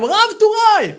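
high loud jeering call; mocking shout. Student calling the rabbi by a mock rank, shouting. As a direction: yell the rank at him as a jeer.

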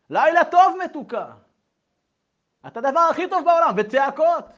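high, loud loving good-night; shouted affection. Shown as a demonstration. Father puts his daughter to bed, shouting lovingly. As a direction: bellow the sweet bedtime words. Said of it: "אתם הולכים לצעוק".

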